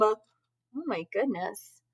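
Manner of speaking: usual speaking voice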